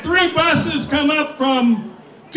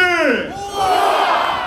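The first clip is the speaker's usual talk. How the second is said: shouted hooah; 5 semitones higher than usual. Real, not acted. Crowd call and response.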